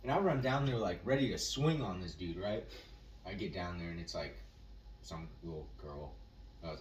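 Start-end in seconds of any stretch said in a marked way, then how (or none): none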